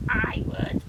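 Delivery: a dry, creaking crusty coral; creaky. A narrator's reading. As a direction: creak it out, loud and unvoiced. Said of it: "creaked a crusty coral".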